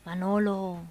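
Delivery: low voice from beyond the grave; ghostly voice. Acted. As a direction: call out like a ghost from the tomb. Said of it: "oye una voz de ultratumba".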